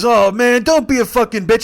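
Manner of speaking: high, loud mock yell of frustration; exasperated outburst. Kevin fed up with his sick buddy, imitated in a loud exasperated yell. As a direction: blow up at a whining friend. Acted outburst, driver replies no screaming.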